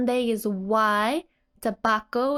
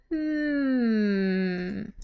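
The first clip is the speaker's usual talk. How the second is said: thoughtful hum. Retold or imitated. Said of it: "She's like".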